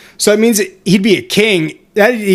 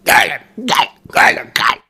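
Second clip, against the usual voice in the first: lightly voiced Gollum croak. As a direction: try a throaty Gollum. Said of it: "I can't do his voice".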